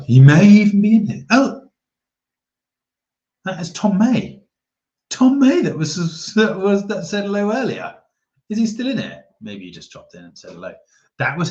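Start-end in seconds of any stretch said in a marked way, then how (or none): 0.0-1.2 sudden high, loud excitement; excited. Real, not acted. Spots subscriber in chat. 1.3-9.2 high, loud delighted realization; thrilled to spot a viewer; excited recognition. Real, not acted. Recognizing subscriber Tom May.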